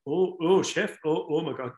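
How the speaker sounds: thick Kerry brogue, lightly done; a hint of a County Kerry accent. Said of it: "thick County Kerry accent".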